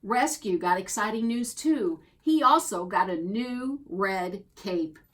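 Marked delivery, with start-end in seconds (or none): none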